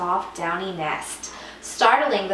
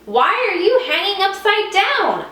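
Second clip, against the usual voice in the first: high, louder puzzled voice; bold stranger bat; 12 semitones higher, 6 dB louder than usual. Acted. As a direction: ask it with loud curiosity. Stranger bat's voice.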